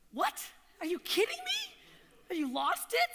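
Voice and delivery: a husband hearing his wife is quitting her job; shocked husband. Retold or imitated. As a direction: react in disbelief. Husband hears she quits job.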